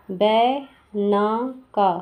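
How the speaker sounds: बै ना का split slowly; syllable by syllable. Shown as a demonstration. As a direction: sound out बै, ना, का one by one. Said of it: "B के बाद".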